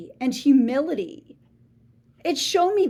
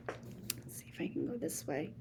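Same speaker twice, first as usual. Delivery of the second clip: whisper; 17 dB quieter than usual. Acted. Quiet aside from character.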